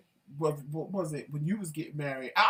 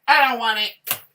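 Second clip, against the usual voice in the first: much higher, louder whining protest; mock whiny refusal; 8 semitones higher, 14 dB louder than usual. Wife imitates husband rejecting his mayonnaise sandwich, high whine. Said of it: "He's like".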